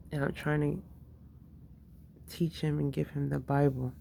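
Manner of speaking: normal talking voice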